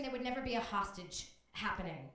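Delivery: normal talking voice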